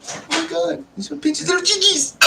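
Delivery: put-on cooing baby talk about a friend's son; cooing over a baby. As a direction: gush like over chubby baby cheeks. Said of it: "we're just going".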